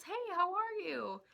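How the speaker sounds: high, loud, slow sing-song hello; imitating friendly greeting. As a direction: greet like the nicest dude on the planet. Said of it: "the nicest dude on the entire planet".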